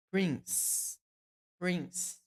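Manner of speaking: "Prince" ending in a crisp s, no vowel after it; final s sound. Shown as a demonstration. Teacher correcting Brazilian students on "Prince". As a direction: clip "Prince" to end on a hissed s. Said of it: "Não é prince, mas".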